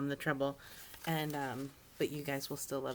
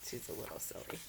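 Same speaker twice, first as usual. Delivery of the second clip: whispered aside; 5 semitones lower, 10 dB quieter than usual. Real, not acted. Reader aside to kids.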